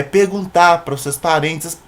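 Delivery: ordinary talk, nothing marked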